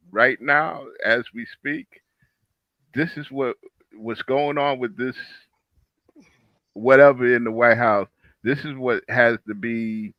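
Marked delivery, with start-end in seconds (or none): none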